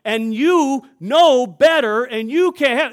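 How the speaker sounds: loud, high scolding manager; manager's loud scolding. Retold or imitated. Manager loudly scolding the pastor in front of the restaurant. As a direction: bark it at the customer like a scolding manager. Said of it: "and he got loud".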